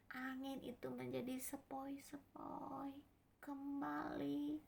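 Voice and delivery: gentle, breath-heavy storytelling; hushed wonder. A narrator's reading. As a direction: let the voice settle like the calmed sea. Storm turns calm, told in a hush.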